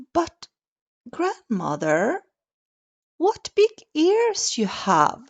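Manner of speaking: put-on high child's voice, wondering; a wide-eyed child; little girl voice.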